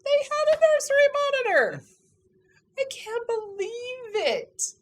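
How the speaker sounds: high-pitched thrilled squeal of discovery; excited disbelief. Retold or imitated. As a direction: relive a lucky find in amazement, voice leaping up. Reliving her delighted find.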